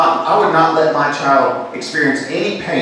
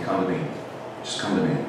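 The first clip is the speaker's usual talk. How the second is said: soft, gentle invitation from Jesus; quiet, loving summons; tender call; 5 semitones lower, 10 dB quieter, much faster than usual. Acted. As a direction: beckon softly.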